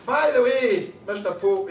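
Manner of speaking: brash wee Glasgow punter hailing the Pope; cheeky call. Acted. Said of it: "So he says".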